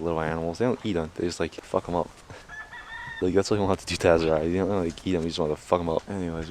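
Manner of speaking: normal talking voice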